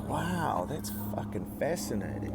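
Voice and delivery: fascinated wonder, lightly re-enacted; retold amazement. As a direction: marvel softly at a discovery. Retells amazed reaction to discovery.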